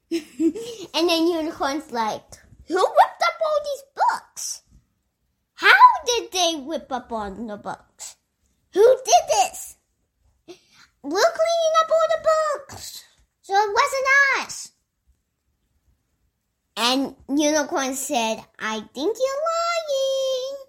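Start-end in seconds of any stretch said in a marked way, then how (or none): 2.7-9.8 high-pitched mock outrage; indignant Unicorn. Unicorn angrily demands culprit. 11.0-14.7 high, squealed denial; protesting children. Acted. Children deny the blame. 18.6-20.6 high, drawn-out accusation; accusing Unicorn. Acted.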